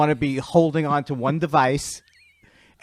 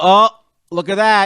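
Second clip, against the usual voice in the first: imitated commentator's loud, high exclamation; sports commentator; 5 semitones higher, 9 dB louder than usual. Mimicking a bobsled commentator who knows the sport, loud exclamation. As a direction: call it out like an impressed commentator. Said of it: "the guy who does the commentating".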